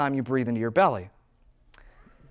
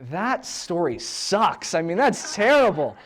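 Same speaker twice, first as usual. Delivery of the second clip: teasing; 6 semitones higher than usual. Retold or imitated. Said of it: "I'm like".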